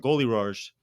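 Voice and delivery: "Goalie wars" with the w of wars slurred toward r; speech slip on goalie wars. Shown as a demonstration. Host repeating own slip, "wars" said like "rawrs". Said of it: "My speech impediment just came out".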